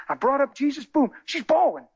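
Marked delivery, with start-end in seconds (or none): none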